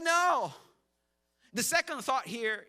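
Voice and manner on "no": emphatic no. Retold or imitated. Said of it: "They're like".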